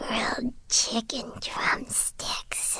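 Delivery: whispered, slow sleep-mumble about food; talking in sleep. Real, not acted. Paimon dreaming of food, mumbling in her sleep. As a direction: mumble it half asleep, barely voiced. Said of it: "was Paimon sleeping just now".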